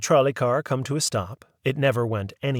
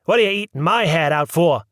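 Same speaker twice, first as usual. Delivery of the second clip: louder exasperated yell; mother scolding, louder; 5 semitones higher, 8 dB louder than usual. A narrator's reading. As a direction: bark it at him. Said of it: "she would probably yell".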